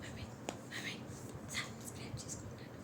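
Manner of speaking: soft, breathless whisper. Shown as a demonstration. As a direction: whisper the line for the child to copy. Mother coaxes child to say it.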